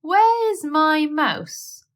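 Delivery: high, slow, grumpy cat question; a vexed cat demanding his supper; cat's demand. A narrator's reading. Simpkin the cat demanding his missing mouse, high and slow. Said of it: "he would have asked".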